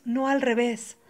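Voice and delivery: normal talking voice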